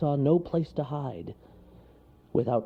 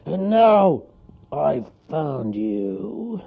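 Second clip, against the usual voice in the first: loud start, long drawn-out finish; menacing bear closing in; growling bear; 10 semitones higher, 7 dB louder, much slower than usual. A narrator's reading. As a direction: growl it triumphantly, stretching the end. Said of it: "growled the bear".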